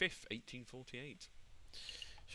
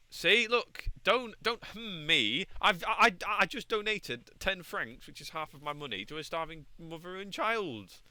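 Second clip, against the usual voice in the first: high, loud and slow, insisting; loud protest; 6 semitones higher, 6 dB louder, slower than usual. Real, not acted. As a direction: protest loudly that you did good. Defending himself, protesting loudly.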